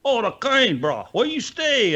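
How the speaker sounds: high, slow mock Pidgin question; Hawaiian Pidgin voice. Locals at the harbor quizzing him, mock Hawaiian Pidgin. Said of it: "everybody's, like, asking me".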